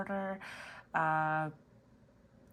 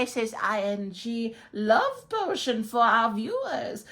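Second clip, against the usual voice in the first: cackling sorcerer casting a spell, very high and loud; wizard voice; 5 semitones higher, 10 dB louder than usual. Acted. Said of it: "Mister Wizard?".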